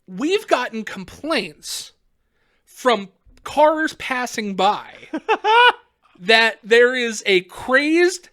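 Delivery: very slow, spaced-out cop delivery; imitated officer choosing words carefully; mock official report. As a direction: lay out each piece deliberately for comic suspense. Retold cop reports complaints.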